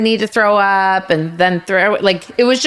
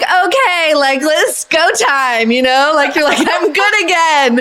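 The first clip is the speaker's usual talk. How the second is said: perky; 8 semitones higher than usual. Retold or imitated. Revived, energetic.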